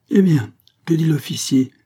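high, loud call across the road. A narrator's reading. Farmer's wife calling to Pitou from afar, loud call. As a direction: call out to the young man. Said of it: "cria la fermière".

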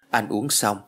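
ordinary delivery

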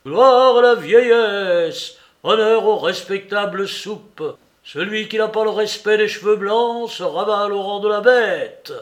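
loud, high declamatory bellow; ringing, shouted tribute; howling. A narrator's reading. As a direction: proclaim it at a yell through the wall. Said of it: "et hurlant".

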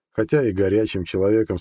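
ordinary delivery

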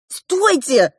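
высокий окрик; крикни, чтобы остановить драку; выкрик. A narrator's reading. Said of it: "крикнула я". (резкий окрик повыше)

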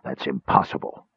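soft, voiceless disbelief; stunned whisper. Acted. Caramon stunned by the kender's news, whispered. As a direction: breathe it out in a whisper. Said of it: "little more than a whisper".

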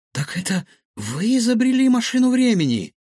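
high, amazed question; astonished. A narrator's reading. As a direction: burst out in amazement at the revelation. Boy astonished by professor's revelation. (изумлённый возглас)

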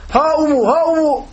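high, ringing call back; imitated shouted answer. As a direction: answer a caller by pitching the voice way up. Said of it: "O daha sesli bir şekilde bağırıyor".

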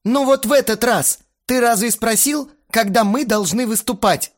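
high, loud challenge; hot-headed exclaiming. A narrator's reading. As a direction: demand it heatedly. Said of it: "Запальчиво воскликнул он". (запальчиво, высоко и громко)

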